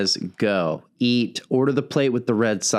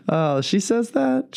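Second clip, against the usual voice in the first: pitched way up and slowed, amused disbelief; delighted surprise; 11 semitones higher than usual. Real, not acted. Host hearing the mother's compliment, high delighted surprise. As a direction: play it like you can't believe she said that.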